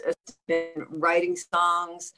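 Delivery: ordinary talk, nothing marked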